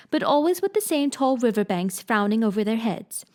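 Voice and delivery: ordinary delivery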